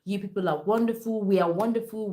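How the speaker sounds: usual speaking voice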